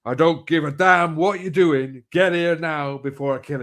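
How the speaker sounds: father orders the mother on the phone, angry. Retold or imitated. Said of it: "He's gone".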